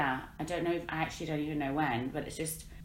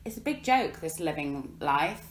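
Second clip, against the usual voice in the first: usual speaking voice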